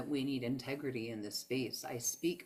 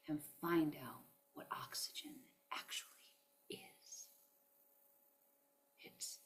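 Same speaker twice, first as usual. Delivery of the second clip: soft whisper; 7 dB quieter than usual. Real, not acted. Coaching viewers to speak life into themselves, whispering. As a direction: hush it down to a breathy secret. Building to an intimate point.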